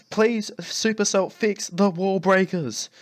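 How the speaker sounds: pleading. Real, not acted. Gamer begging Supercell to fix the wall breakers, slow pleading. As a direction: drag it out, begging.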